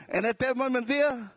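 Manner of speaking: ordinary delivery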